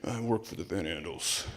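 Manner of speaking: the socialist quietly admitting his employer, low mock voice; deadpan punchline. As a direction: drop it low and flat for the reveal. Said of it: "He's like".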